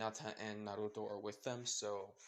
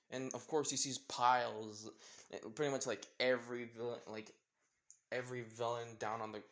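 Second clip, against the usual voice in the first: plain reading voice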